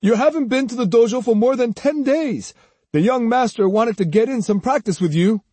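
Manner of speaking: high, reproachful scolding; demanding. A narrator's reading. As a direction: take a truant to task. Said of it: "Ryohei demanded".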